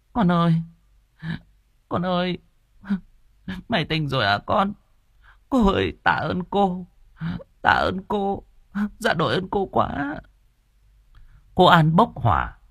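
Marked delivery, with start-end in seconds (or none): 0.1-10.2 high, wailing mother's voice; tearful gratitude. A narrator's reading. Mother hugging her revived son, tearfully thanking the visitor. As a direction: wail thanks between pauses. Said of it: "rối rít cảm ơn cô An".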